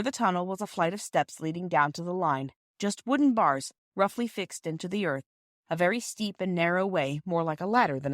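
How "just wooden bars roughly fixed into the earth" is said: ordinary delivery, nothing marked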